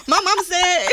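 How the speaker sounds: high, loud country mimicry; imitated country accent. Coworker mimicking her phone talk, exaggerated country accent. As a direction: ham up the country accent loudly. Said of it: "It's so country".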